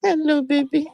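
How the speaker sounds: high cooing greeting. Real, not acted. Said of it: "TikTok did not like to hear baby voice".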